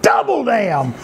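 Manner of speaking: high mock holler, falling at the end; retold holler. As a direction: imitate a pained curse without really shouting. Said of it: "he hollers".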